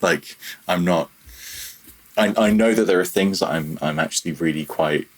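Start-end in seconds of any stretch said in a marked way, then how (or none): none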